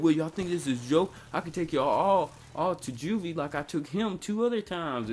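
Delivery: mock-indignant telling-off; scolding officer. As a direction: threaten the kids like an irritated officer. Officer threatens the laughing kids.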